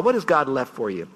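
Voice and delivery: usual speaking voice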